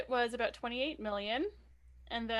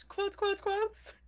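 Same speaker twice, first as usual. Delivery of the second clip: teasing singsong; 10 semitones higher, much slower than usual. Real, not acted. Teasing repeated word.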